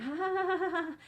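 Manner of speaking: short high laugh; a quick, bright burst of laughter. Real, not acted.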